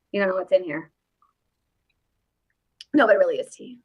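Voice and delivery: normal talking voice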